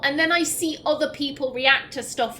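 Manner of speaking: usual speaking voice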